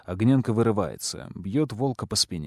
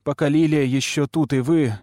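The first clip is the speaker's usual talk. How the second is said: louder, higher, hurried plea; urgent interruption; 5 semitones higher, 5 dB louder than usual. A narrator's reading. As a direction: rush in before she leaves. (взволнованно, громче)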